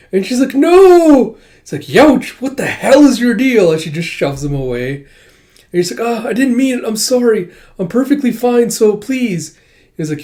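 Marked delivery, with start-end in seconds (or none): 0.6-1.4 high, loud drawn-out protest; flustered shout. Acted. Flustered girl shouts and shoves him. 1.8-3.7 high, loud acted outburst; yelped protest. Shoved man yelps in protest. 6.0-9.6 high-pitched acted pleading; flustered apology.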